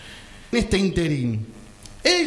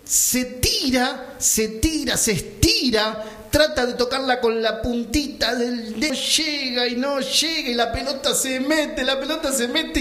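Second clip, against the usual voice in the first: higher, stretched build toward the goal; 4 semitones higher than usual. Acted. Suspenseful telling of keeper's dive.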